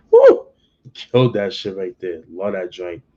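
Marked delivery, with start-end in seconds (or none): 0.1-0.5 a high, loud woo of excitement; hyped woo. Real, not acted. Reviewer hyped by a verse he just quoted, loud high woo. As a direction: shout a joyful woo after the bar. Reacting to a lyric he loves.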